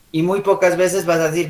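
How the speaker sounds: usual speaking voice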